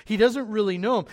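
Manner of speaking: usual speaking voice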